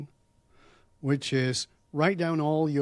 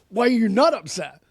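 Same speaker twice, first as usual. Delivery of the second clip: mock-indignant, higher and louder comeback; retold upset retort; 8 semitones higher, 8 dB louder than usual. Upset pupil challenging his calm teacher, loud indignant retort. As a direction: throw the question back in loud disbelief. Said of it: "I'm very upset".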